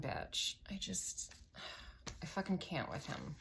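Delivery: sighing, whispered aside; exasperated whisper. Real, not acted. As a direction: breathe it out like you're done with him. Exasperated aside about Jacob.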